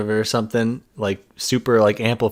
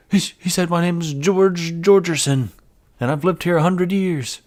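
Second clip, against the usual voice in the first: high, drawn-out awed announcement; hammed-up ghost-hunter amazement, stretched out; mock dramatic; 7 semitones higher than usual. Parodying hunters decoding static, mock amazed.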